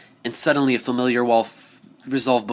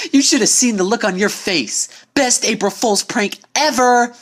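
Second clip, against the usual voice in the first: higher, louder, cocky brag; gloating tomboy; 13 semitones higher, 5 dB louder than usual. Acted. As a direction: crow over the prank. Rainbow Dash gloating over prank.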